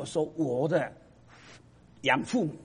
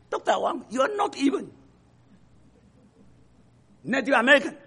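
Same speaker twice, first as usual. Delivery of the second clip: mocking repeat; 9 semitones higher, 6 dB louder than usual. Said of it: "差到这个程度".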